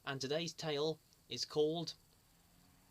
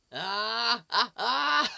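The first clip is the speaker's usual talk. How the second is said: mock startled yelps; 4 semitones higher, 10 dB louder than usual. Presenter startled by magic trick.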